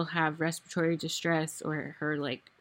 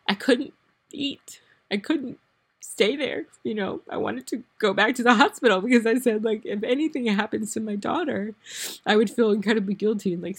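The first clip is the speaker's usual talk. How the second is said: higher, tearful and upset; real emotional; 4 semitones higher, 4 dB louder than usual. Real, not acted. Mother recalls leaving her daughter in hospital, emotional. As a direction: push the words out through rising real emotion. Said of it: "sorry, I'm emotional again".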